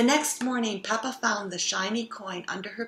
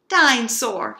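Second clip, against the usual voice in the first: Dinosaur growled, much louder like a little monster; 3 semitones higher, 10 dB louder than usual. Acted. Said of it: "he growled".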